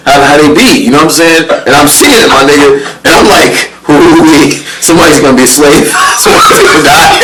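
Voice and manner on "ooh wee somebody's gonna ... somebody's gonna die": loud, laughing disbelief; excited alarm. Retold or imitated. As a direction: shout it half laughing. Scared thoughts, shouted laughing.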